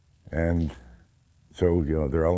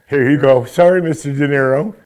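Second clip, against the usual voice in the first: loud, high, eager-to-please apology; mock a nervous actor sucking up; hammed-up groveling to a star; fawning actor; 9 semitones higher, 10 dB louder than usual. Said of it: "every other actor picked it up".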